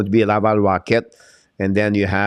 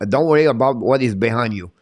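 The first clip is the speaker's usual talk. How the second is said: ordinary delivery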